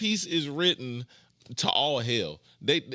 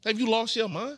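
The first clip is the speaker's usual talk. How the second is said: pitched way up in disbelief; outraged disbelief; 8 semitones higher than usual. Acted. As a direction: cry out in shocked disbelief. Repeats louder, higher.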